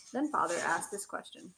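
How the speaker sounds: usual speaking voice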